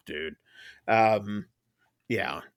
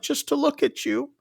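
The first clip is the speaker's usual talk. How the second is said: high, softer mock-weepy plea; imitated tearful voice; 12 semitones higher, 5 dB quieter than usual. Retelling the captor looking at the boy, mock tearful. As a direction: lightly act a man tearing up. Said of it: "there's tears in his eyes".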